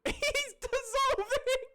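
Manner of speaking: very high, slow, squealing through laughter; barely getting the words out for laughing; laughing hysterically. Real, not acted. Cracking up at the image.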